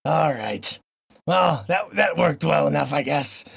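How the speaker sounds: ordinary talk, nothing marked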